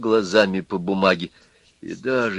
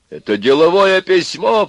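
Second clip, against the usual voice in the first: higher, louder indignant cry; 8 semitones higher, 6 dB louder than usual. A narrator's reading. Husband cries out to wife that the note is business. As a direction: exclaim it, caught and defensive. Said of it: "вскричал Аристарх".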